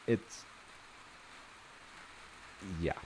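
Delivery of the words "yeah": ordinary delivery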